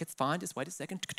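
soft, low clerk brush-off; quiet imitation of a preoccupied clerk; imitated distracted reassurance. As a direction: murmur it while busy at a keyboard. Check-in lady fobbing him off.

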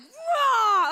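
a sudden high, loud roar of outrage at the umpire; outraged shout. Retold or imitated. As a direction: blast it like a furious sports mom. Angry at umpire's bad call.

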